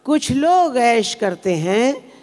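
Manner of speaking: drawn-out phrase endings with rising and falling lilt; ghazal recitation. Acted. Poet reciting a requested ghazal to the audience, measured lilting recitation.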